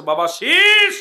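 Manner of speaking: high, rising call with a long last word. Acted. Adam calling his son Shish, high calling out.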